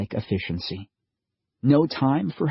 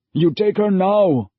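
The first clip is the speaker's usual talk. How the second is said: higher, louder, urgent father voice; father pleading, raised and strained; desperate father; 9 semitones higher, 5 dB louder than usual. A narrator's reading. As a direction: push her into the stranger's arms. Father repeats order.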